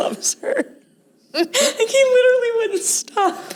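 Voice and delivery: high laughing squeals through the words; cracking up as she tells it. Real, not acted. Laughing to her co-host about the movie.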